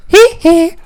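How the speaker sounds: very high, loud squealed giggle; two sharp falsetto squeaks; put-on high-pitched "hee hee". Playful falsetto giggle noise.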